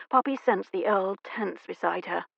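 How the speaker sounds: usual speaking voice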